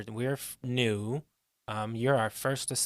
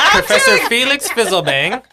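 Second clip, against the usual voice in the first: cracking up on a silly name; loud, high, helpless laughter in the words; laughing through it; 10 semitones higher, 14 dB louder than usual. Real, not acted. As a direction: barely get the name out laughing. Player cracks up saying the name.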